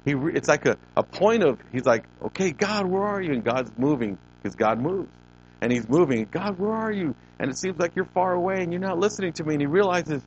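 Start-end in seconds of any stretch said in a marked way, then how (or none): 2.2-3.3 high-pitched imitated appeal; acted desperate plea. Preacher voicing David searching for God, high pleading. 6.3-9.5 high, hurried imitated plea; acted pleading complaint. Preacher voicing David who feels unheard, high pleading complaint.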